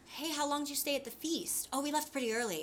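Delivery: high, light mock nonchalance; feigned casualness. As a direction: act breezily as if nothing happened. Boys acting innocent about feast.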